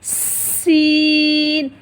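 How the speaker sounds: सीन drawn out very slow with a thin whistling s; whistling letter. Shown as a demonstration. Teacher shows students the thin whistling sound. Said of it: "बारीक होगी लेकिन सीटी की तरह".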